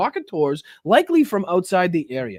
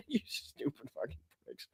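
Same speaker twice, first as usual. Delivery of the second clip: softer words broken up by laughter; laughing insult; 3 semitones lower, 16 dB quieter than usual. Real, not acted. Streamer dismissing the debaters under the breath, laughing. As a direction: chuckle through the insult.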